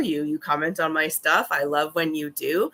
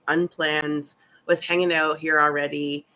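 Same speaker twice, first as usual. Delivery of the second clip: ordinary talk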